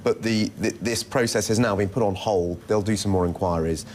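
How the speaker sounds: ordinary talk, nothing marked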